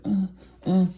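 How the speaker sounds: high-pitched imitated moaning uh uh; jokey high moan sound; mock moan. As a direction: mimic a woman moaning, briefly. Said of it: "He hear Ashley moaning".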